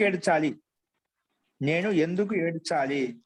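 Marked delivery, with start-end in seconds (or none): 1.6-3.2 slowly, word by word for students writing; slow dictation. Shown as a demonstration. Teacher repeating a Sanskrit lesson's translation for students, slow dictation. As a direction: draw the sentence out for note-taking.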